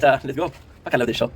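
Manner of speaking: ordinary delivery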